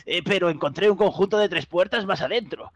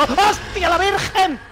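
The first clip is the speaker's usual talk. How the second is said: shocked yelling high; startled shout; 12 semitones higher, 3 dB louder, slower than usual. Real, not acted. Startled by the game creature.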